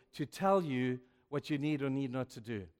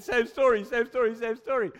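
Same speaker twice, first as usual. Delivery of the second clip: louder and higher, a rising repeated exclaim; building excitement; 8 semitones higher, 8 dB louder than usual. Real, not acted. Preacher telling the congregation of the other men, excited and loud. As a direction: drive it home with mounting fervour.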